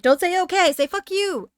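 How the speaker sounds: high, loud mock insistence; imitated friend urging. Friend coaching the too-nice streamer, loudly insistent. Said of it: "And she's like".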